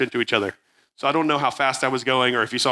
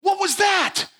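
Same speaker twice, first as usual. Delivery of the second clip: indignant yell; much slower than usual. Retold or imitated. Narrator demanding answers from the friend who didn't help, shouted high. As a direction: call him out, voice cracking up high. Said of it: "I'm like".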